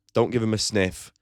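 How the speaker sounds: normal talking voice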